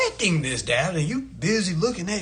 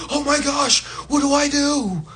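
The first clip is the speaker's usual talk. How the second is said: higher mock fretting over lost money; mock panic; 5 semitones higher than usual. People following society fretting over bank money, lightly mocking. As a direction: whine like you just lost everything, half laughing. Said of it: "you gonna be".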